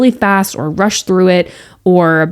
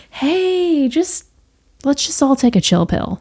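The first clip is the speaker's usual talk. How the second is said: soft and higher, gently coaxing; loving, reassuring; 6 semitones higher, 4 dB quieter than usual. Shown as a demonstration. Host coaxing stressed listeners to relax, soft and loving. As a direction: soothe them like a worried friend. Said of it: "loving, reassuring way to encourage you".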